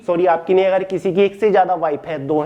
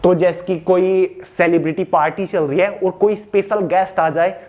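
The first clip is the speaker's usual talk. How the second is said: normal talking voice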